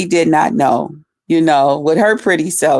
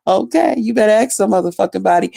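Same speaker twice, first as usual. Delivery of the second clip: usual speaking voice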